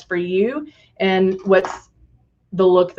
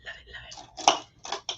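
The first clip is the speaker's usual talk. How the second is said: whispering; 16 dB quieter than usual. Real, not acted. Whispered love it to herself.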